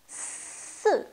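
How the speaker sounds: « Ce » soufflé, sifflement appuyé; « Ce » en serpent, bien sifflé; si sifflé accentué. Shown as a demonstration. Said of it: "accentuez ce bruit". (si avec le bruit accentué)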